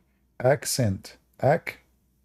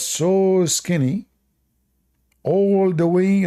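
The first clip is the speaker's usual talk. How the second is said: stretched exaggeration; 6 semitones higher, much slower than usual. Real, not acted. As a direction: paint it as impossibly far. Describing a huge distance.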